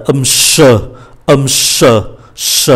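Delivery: forceful hushing "sh" for French ch; strong sh. Shown as a demonstration. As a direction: push plenty of air through "sh". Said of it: "rất là mạnh". ("sh" bật hơi rất mạnh)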